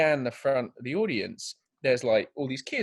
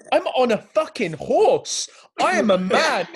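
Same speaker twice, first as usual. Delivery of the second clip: loud, high-pitched indignant rant; mock outrage; 11 semitones higher, 7 dB louder, slower than usual. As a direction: rant at a kid in loud disbelief. Retold outraged rant at kid.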